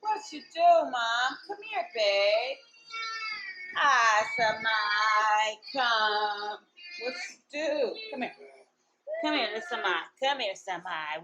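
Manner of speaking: loud, high calls to a little one. Real, not acted. Mom calls out to her baby.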